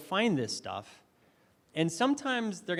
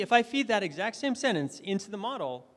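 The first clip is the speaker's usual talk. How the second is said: ordinary talk, nothing marked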